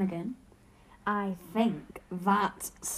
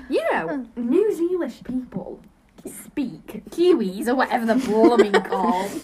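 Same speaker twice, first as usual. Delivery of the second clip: mock Kiwi; 7 dB louder than usual.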